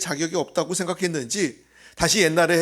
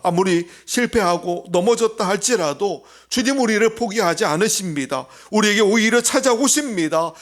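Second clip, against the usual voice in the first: rising, louder sermon climax; full-force preaching; 4 semitones higher, 4 dB louder than usual. Real, not acted. Preacher to congregation, building to a loud climax. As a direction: drive the promise home to the congregation.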